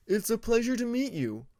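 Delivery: high, bright, grinning voice; grinning Alligator. Acted. Alligator grinning as he meets Moon, the Nightwing. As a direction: greet a new friend with a wide grin.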